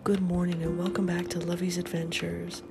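ordinary talk

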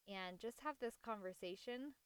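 ordinary talk, nothing marked

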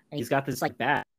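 ordinary talk

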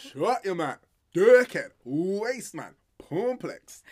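loud, high-pitched string of insults; quoting Jamie's insults. Retold or imitated. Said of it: "Jamie was a genius for that one".

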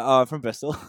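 loud put-on West Country accent, half laughing. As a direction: play a fake Bristol local in West Country. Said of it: "I started talking in a West Country accent".

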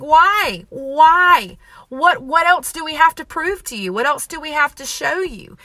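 venting frustration at God; frustrated. Retold or imitated. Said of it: "I get frustrated and I'm like".

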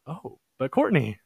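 high, sing-song teasing voice climbing up; playful teasing question. Retold or imitated. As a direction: tease her gently, pitch soaring up. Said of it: "I'm like".

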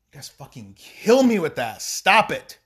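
ordinary talk, nothing marked

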